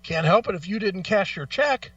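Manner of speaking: ordinary delivery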